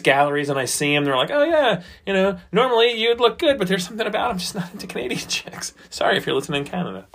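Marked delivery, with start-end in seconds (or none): 1.3-5.7 mock onlooker. Voicing people judging gallery photos.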